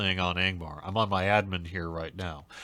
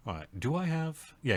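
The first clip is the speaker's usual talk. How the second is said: ordinary delivery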